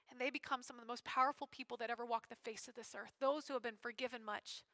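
ordinary delivery

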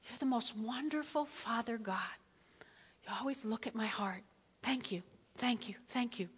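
soft whispered thanks to God; hushed grateful prayer in a whisper. Shown as a demonstration. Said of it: "get real quiet for a second".

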